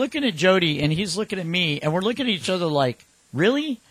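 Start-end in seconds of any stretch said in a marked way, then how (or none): 3.3-3.8 incredulous. Retold or imitated. Exchanging looks at noisy kid.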